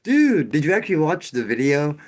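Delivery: high, loud mock disbelief; exasperated retort. Replaying a retort to the viewer who missed the sarcasm, exasperated. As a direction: fire back at a clueless viewer, incredulous. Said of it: "You know, it's like".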